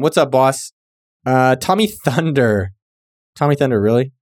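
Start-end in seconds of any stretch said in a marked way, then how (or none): none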